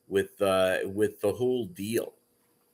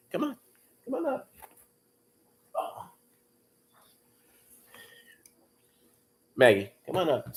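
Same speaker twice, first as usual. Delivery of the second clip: high coaxing pet voice; talking to a dog; 8 semitones higher, faster than usual. Real, not acted. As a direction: sweet-talk the dog up.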